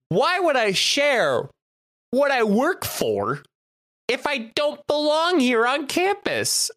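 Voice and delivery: high, slow, mock-offended reply; indignant retort. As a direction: drag the words out, affronted. Indignant reply to officer, retold.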